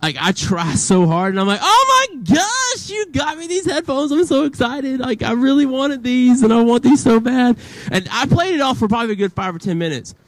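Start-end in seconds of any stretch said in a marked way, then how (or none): 1.6-7.6 high, slow, over-the-top fake delight; badly faked surprise, pitched way up. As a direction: gush like you never saw it coming. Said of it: "I'm a really bad liar when it comes to, like, acting surprised".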